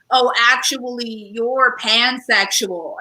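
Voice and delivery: slow, know-it-all correction; drawn out, correcting someone online; lightly mocked online corrector. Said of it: "They were like".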